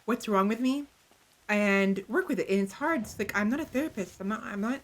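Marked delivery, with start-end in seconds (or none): none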